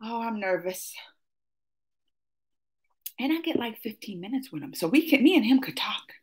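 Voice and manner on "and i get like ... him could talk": hushed, breathy secret whisper to the audience. Real, not acted. Secret confiding to viewers.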